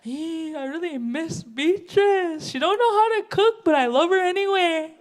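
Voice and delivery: high, pleading mock man missing her; whiny ex-boyfriend. Ex-boyfriend crying to her sisters, high pleading whine. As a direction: whine it like a lovesick ex. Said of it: "they'll cry around and they'll say".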